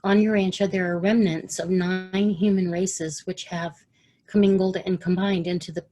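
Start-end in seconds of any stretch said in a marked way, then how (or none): none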